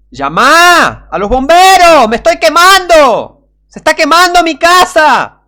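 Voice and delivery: high, slow, desperate yell for the firemen; frantic scream. Acted. Man trapped in a burning house screams for firemen, desperate high yell. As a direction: shout for your life as the house burns.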